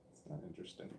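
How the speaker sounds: whispered self-dismissal; whispered aside. Real, not acted. Vlogger dismissing his own accent tangent in a whisper. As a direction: drop to a whisper, writing yourself off.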